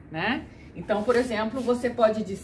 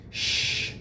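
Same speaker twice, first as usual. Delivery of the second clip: long voiceless sch hiss. Shown as a demonstration. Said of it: "esse som".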